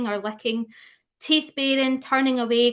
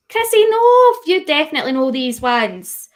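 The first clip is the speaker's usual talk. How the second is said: high, loud teasing no; playful protest; 5 semitones higher, 9 dB louder than usual. Real, not acted. Teasing a chat viewer, high loud playful protest. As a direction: scold a friend in fun.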